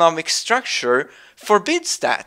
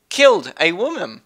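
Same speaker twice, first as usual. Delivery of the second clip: usual speaking voice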